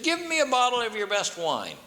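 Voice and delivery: high, haughty royal demand; pompous king. A narrator's reading. King demands free wine from the shopkeeper, haughty. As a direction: order it like you own the shop.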